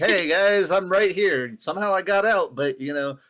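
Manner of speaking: high, loud and overly cheerful; cheery escaped prisoner. Pretend. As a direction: bounce back in like nothing happened. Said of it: "I'd be a terrible person to be like".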